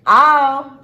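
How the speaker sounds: shouted emphasis; loud. Real, not acted. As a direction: punch it out loud. Outro emphasis.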